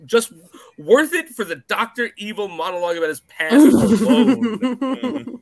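gushing through a laugh; laughing praise. Real, not acted. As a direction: rave about it, laughing. Gushing about favourite monologue.

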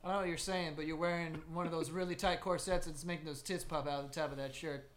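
rushed, fast rambling reply; fast, confused cowboy. Retold or imitated. Cowboy answering the saloon girl, fast confused rambling. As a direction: gabble it out fast, half lost. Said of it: "It's like".

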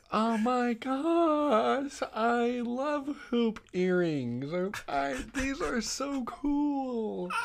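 high, drawn-out gushing with fake delight; sarcastic. Bratty cousin faking love for her gift, sarcastic. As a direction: stretch each word in mock enthusiasm. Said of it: "obviously being sarcastic".

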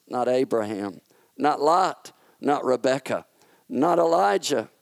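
plain reading voice

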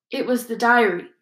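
plain reading voice

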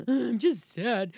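slow, loud, teary voice; tearful. Retold or imitated. Mother crying in the car to her son, slow tearful words. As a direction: choke it out through tears. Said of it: "I'm sitting there crying".

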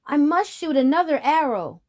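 high, loud determined voice; urgent. Acted. Boy in danger.

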